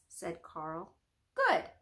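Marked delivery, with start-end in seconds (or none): 1.3-1.7 high, bright approving word; a pleased little nod in the voice; Stillwater approving. Acted. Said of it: "said Stillwater".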